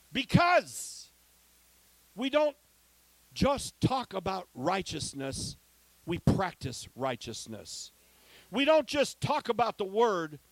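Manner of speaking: preaching. Acted. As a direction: build each line and drop it on the answer. Preacher's rhetorical climax.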